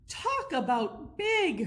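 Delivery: slow awed exclamation; amazed. A narrator's reading. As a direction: marvel at the size. Penguins gawk at New York.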